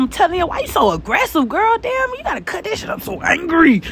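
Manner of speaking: can't believe how hard she cuts; rising, disbelieving tease; incredulous. Real, not acted. Scolding the aggressive cook.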